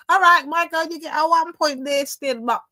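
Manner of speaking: high, loud burst of approval; loud concession. Real, not acted. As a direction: award the point loudly, pitched right up. Loudly concedes her a point.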